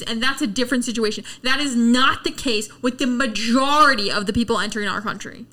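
ordinary delivery

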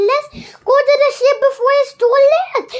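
high, loud, hurried urging; urgent shout. A narrator's reading. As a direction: rush it out loudly to get them moving. Pirates fleeing the storm.

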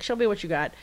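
usual speaking voice

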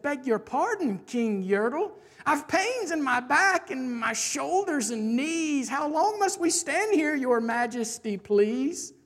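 timid subject petitioning a king; meek little turtle, pleading. Acted. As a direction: ask humbly from the bottom of the stack. Humble turtle Mac pleads with the king.

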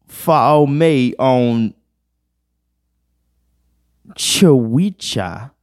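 put-on slow, laboured British accent; mock British accent. Puzzling out a British meme, mock British accent. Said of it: "British people be like".

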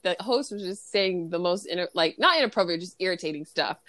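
ordinary talk

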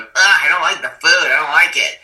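high, loud mock whine of disgust; disgusted complaint. His wife rejecting the meal-plan food, a loud disgusted whine. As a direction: groan it like a picky eater. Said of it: "trying it and going".

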